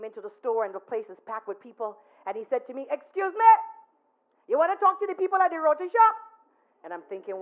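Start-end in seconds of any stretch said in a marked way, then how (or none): 2.9-6.1 high and loud, then quickening; loud, high imitation of the man; loud interruption. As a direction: cut into a packed store. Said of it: "he never ever once talked softly".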